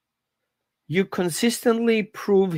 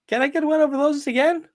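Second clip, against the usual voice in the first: higher, hopeful voice for the dog; eager dog voice; 8 semitones higher than usual. Retold or imitated. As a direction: play the dog begging for more. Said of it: "your dog is like".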